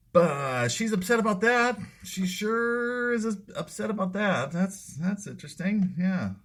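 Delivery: high mocking sing-song; sarcastic. Sarcastic about her upset.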